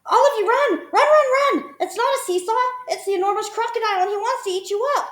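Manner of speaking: high, loud, fast chattering alarm; frantic monkey warning. A narrator's reading. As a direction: rush them to safety. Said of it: "Mugglewump shouted".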